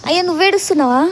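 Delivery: high, loud exclamation at a friend's tears; loud, high-pitched questioning; exclaiming concern. Real, not acted. Reacts to friend crying.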